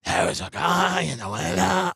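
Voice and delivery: mocking a friend's affected creepy voice; put-on affectation. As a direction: drag it out like a ghost story. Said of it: "putting on an affectation".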